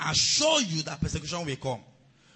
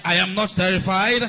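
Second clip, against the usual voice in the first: much louder, rising shouted declaration; 10 dB louder than usual. Shown as a demonstration. Preacher urging the congregation to shout louder. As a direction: shout it out with full voice. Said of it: "Do you still have a voice?".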